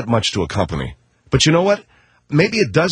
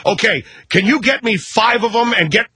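higher, pushy shopper demand; 8 semitones higher than usual. Retold or imitated. Parent pressing the clerk for more dolls, pushy and high. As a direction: rush the clerk for five.

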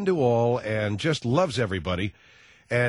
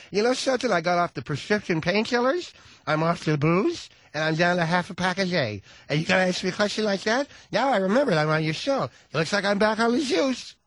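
higher, put-on diva rant; ranting Liza; 6 semitones higher than usual. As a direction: spill it out like a frazzled star.

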